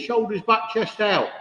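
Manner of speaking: "Shoulders back, chest out" barked high as a mock drill order; mock sergeant major giving orders. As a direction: snap "back" and "out" like a parade-ground command.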